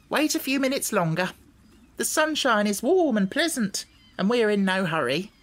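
high-pitched hen, reassuring; high, lilting hen character; unhurried yellow hen. Acted. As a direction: advise patience as a talking hen. Narrator voices the hen.

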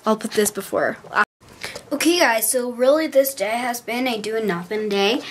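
normal talking voice

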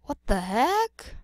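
baffled, rising at the end; rising disbelief. Acted. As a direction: question rising sharply at the end. Same question repeated, escalating.

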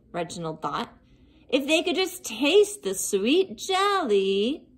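usual speaking voice

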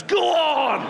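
high, drawn-out urging yell; hammed-up coach's cheer; Olympic coach. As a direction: send them off like a coach at the start. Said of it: "pushes me off like an Olympic coach".

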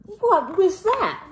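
startled. A narrator's reading. Kitten startled by mirror.

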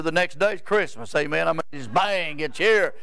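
usual speaking voice

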